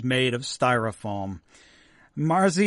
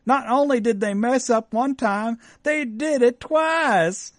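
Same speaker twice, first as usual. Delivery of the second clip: higher, slow, put-on Texan accent; upset Texan mom; 8 semitones higher, slower than usual. Host voices the humiliated mom in a Texan accent. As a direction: whine it like the let-down mom in Texas.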